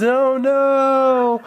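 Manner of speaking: high, loud cry of despair; screaming in dismay. Acted. English teacher screams as his car is smashed. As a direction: shriek it like your world just ended. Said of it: "The English teacher screams".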